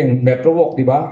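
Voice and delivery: ordinary talk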